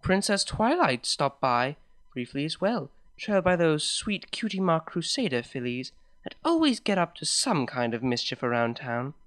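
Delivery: ordinary delivery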